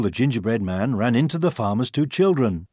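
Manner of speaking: ordinary delivery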